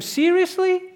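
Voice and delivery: high, stunned disbelief; can you believe it; incredulous. Real, not acted. Preacher's incredulous aside.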